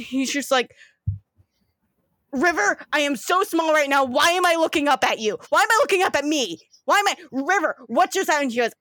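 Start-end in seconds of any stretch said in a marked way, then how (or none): 2.3-8.5 higher-pitched flustered questioning; bewildered protest. Acted. Body-swapped brother demanding answers from River, flustered and higher. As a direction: rattle off baffled questions, pitch raised.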